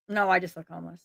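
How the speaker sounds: ordinary delivery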